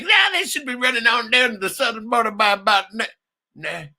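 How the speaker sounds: loud, high mock cowboy shout; shouted movie-villain voice; voicing the bad guys. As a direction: ham up the villains bragging. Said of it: "They're like".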